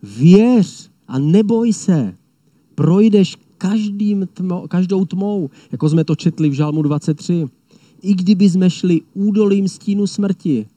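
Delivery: the lion of Judah calling out courage to believers; bold reassurance. Retold or imitated. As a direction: declare it with ringing strength. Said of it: "Říká".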